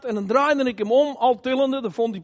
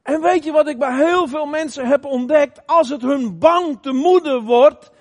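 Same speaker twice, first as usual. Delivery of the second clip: usual speaking voice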